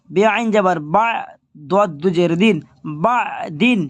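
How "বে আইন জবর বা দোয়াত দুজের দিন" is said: ordinary talk, nothing marked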